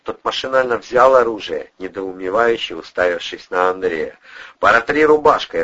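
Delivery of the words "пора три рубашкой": loud, high command; forceful instruction; giving orders. A narrator's reading. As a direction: order him briskly, voice raised. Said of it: "распорядился Бандура".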